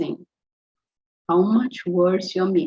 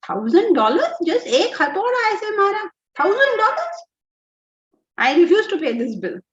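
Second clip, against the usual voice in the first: high, incredulous mock outrage; very irritated; 11 semitones higher, 5 dB louder than usual. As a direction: act out an indignant customer protesting a bill. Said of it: "this chap got very irritated".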